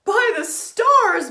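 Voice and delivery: very high, loud cry of joy; joyful cry. Acted. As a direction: cheer it out. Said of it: "Bismarck cried".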